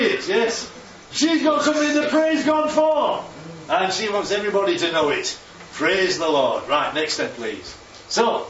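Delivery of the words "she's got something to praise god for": high and loud, bursting with joy; exuberant. Acted. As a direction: proclaim it like good news. Preacher's exuberant praise.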